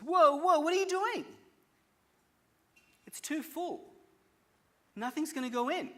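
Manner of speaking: high, loud startled cry; alarmed. Retold or imitated. Psychiatrist startled as the monk overfills his jug, high alarm. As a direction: yelp as the water spills over.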